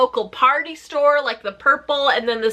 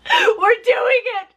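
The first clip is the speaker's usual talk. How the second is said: mock triumphant cheer; 10 semitones higher, slower than usual. Mocking wrestlers' triumph.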